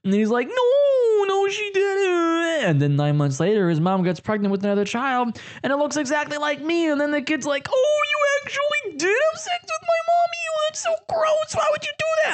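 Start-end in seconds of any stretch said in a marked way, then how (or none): 0.5-2.7 higher, slow drawn-out protest; whiny denial. Retold or imitated. Voiced listener protesting again. 7.7-12.3 put-on much higher, childlike squeal; little kid voice.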